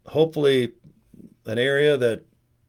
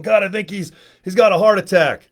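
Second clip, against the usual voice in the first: mock paramedic shout; 6 semitones higher, 6 dB louder than usual. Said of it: "on my chest going".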